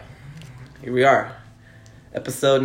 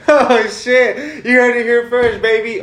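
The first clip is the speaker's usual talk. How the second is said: excited; 9 semitones higher, 5 dB louder than usual. Real, not acted. Reacts to a bold opinion.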